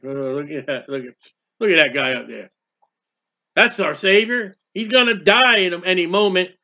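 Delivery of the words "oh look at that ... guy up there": low mocking onlooker. Mocker points at Jesus.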